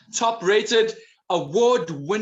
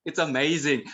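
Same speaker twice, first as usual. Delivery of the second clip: normal talking voice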